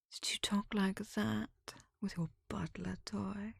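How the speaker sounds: soft, venomous whisper fading away; taunting whisper. Acted. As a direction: twist the knife quietly. Rival taunts bride in whisper.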